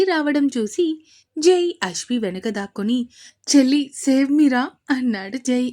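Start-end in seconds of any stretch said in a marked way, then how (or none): 3.5-4.6 mock panicked plea. Jai hiding behind sister from Advaith.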